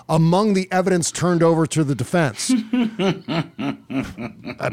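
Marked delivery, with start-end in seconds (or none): 2.6-3.5 a burst of amused laughter; real laugh. Real, not acted. Host laughs at news story.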